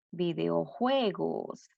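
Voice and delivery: Videojuegos drawn out slowly, leaning on -jue-; slow syllable demo. Shown as a demonstration. Teacher showing pupils the stress of the new word, slowly. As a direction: sound out Videojuegos slowly for the class. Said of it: "se convierte en una palabra grave".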